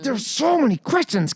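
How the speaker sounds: high squeal of frustration; exasperated bafflement. Real, not acted. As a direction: wail it in comic despair. Exasperated rant at plot holes.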